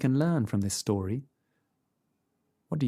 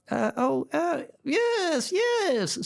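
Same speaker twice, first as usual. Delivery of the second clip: much higher croaky fake granny; croaky grandmother voice; much slower than usual. A narrator's reading. Wolf in bed faking grandmother, high and croaky.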